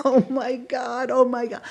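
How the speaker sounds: high-pitched awestruck exclaiming; retold amazement. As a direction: replay being blown away, pitch way up. Retelling her amazed reaction to audition.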